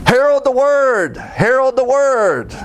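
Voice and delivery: preacher at full voice, lifting each word; heralding cry. Shown as a demonstration. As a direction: call it out like a herald. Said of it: "but I can still voice it out".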